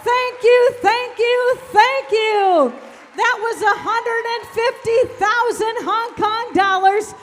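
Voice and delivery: loud triumphant sold call; excited shout. Real, not acted. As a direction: shout it out to the room. Auctioneer announces sale, shouting.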